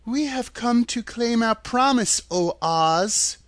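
put-on a girl addressing a wizard; young heroine Dorothy. A narrator's reading. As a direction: lift the voice into a girl's earnest demand. Dorothy voiced in story.

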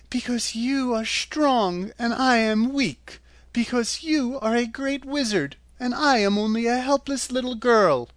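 put-on little girl voice. A narrator's reading. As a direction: plead up high like a small girl. Dorothy pleads with Oz.

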